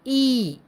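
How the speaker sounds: a sustained teaching ई; long ee. Shown as a demonstration. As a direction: demonstrate a stretched ई sound. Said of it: "इस प्रकार से".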